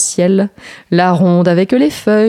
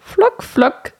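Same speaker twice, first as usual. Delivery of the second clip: rain dripping sound; 12 semitones higher than usual. A narrator's reading. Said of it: "dit la pluie".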